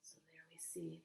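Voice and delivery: ordinary delivery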